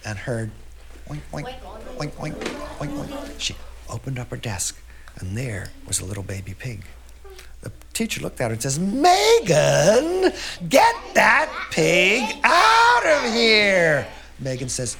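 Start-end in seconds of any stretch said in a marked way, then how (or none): 1.1-3.3 soft, small oinks in pairs; little pig oinks. A narrator's reading. Girl hears baby pig in her desk, soft oinking. 8.8-14.1 loud, high, drawn-out order; teacher's yell. A narrator's reading. Teacher orders girl to remove piglet, yelling. Teacher yells at Megan about pig.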